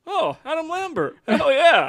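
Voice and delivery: high, loud thrilled shout; excited fan impression. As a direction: play the giddy fan. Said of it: "I could just see you right now".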